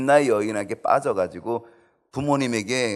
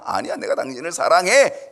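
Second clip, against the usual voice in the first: Samson protesting; 6 semitones higher, 8 dB louder than usual. Acted. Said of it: "이렇게 해서".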